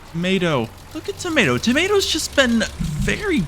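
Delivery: thrilled at the tomato price; excited gasp. Real, not acted. Player delighting in the high tomato price, gasping excitedly.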